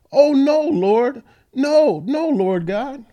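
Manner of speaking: high, loud anguished cry of No, Lord; pleading. Acted. Jeremiah pleading with the Lord, high, loud and anguished. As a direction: beg the Lord in high distress. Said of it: "So Jeremiah is pleading".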